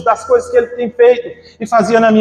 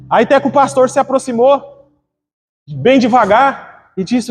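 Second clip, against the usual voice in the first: ordinary talk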